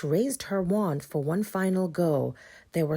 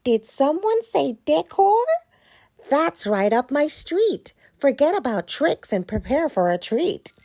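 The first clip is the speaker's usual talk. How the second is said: cheerful pumpkin voice; 9 semitones higher, 6 dB louder than usual. Acted. Chris gets excited.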